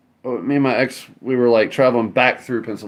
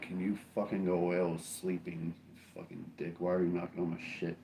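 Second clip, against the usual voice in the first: much softer, annoyed half-asleep grumble; grumpy sleepy mutter; 2 semitones lower, 12 dB quieter than usual. Retold or imitated. Woken at seven, grumbling quietly at the cops. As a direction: mutter it like you were woken.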